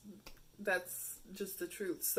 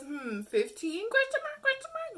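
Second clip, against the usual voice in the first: mocking uncertainty; 8 dB louder than usual. Mimicking a Tinder guy's unsure answer, high and mocking. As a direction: act out his clueless doubt. Reading his unsure reply.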